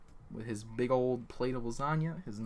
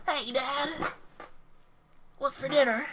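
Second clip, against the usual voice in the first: high-pitched little-boy cartoon voice; Gohan; 13 semitones higher than usual. Son asking dad about dinner, high cartoon-boy voice. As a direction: pipe up high like an anime kid. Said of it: "He says".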